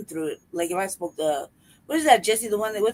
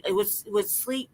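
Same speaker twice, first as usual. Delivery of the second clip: normal talking voice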